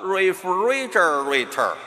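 slow American refrigerator with heavy r's; American accent. Shown as a demonstration. Teacher showing American pronunciation, exaggerated r-coloured American accent. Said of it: "刚才这就是美式发音".

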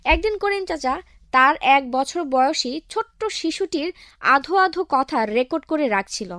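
ordinary talk, nothing marked

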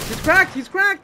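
loud, high excited shout; combat callout. Real, not acted. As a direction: shout it to your teammate. Shouting enemy shield broken.

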